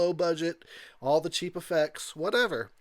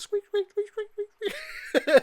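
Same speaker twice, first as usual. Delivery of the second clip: high, squeaky bedspring squeaks while laughing; squeaky imitated squeaks through laughter; squeak noises; 4 dB louder, much slower than usual. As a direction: mimic creaky bedsprings. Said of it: "you heard, like, some squeaking".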